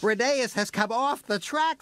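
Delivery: high, alarmed engine voice; worried high-pitched report; Skarloey crying out. A narrator's reading. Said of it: "Skarloey cried".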